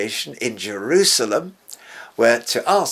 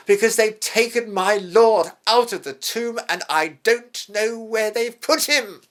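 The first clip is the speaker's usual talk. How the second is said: much higher, louder, distressed plea; Mary's grieving plea; 11 semitones higher, 5 dB louder than usual. Retold or imitated. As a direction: voice her loss, pleading and pained. Grieving Mary answers the angels.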